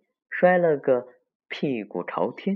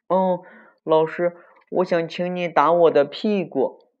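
put-on high, loud little-kid request; childlike, insistent asking; eager child; 7 semitones higher, 6 dB louder than usual. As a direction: pipe up to the teacher, word by word. Child makes request to teacher.

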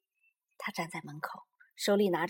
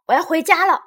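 high, loud, huffy shout; angry announcement; 9 semitones higher, 11 dB louder than usual. A narrator's reading. Little Bear quits and leaves, loud and angry. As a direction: shout it as you storm off. Storming off after the quarrel.

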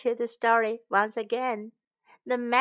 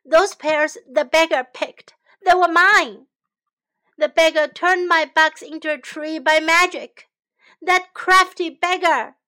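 angry rant; 4 semitones higher, 8 dB louder than usual. Acted. Shen's tirade after being tricked.

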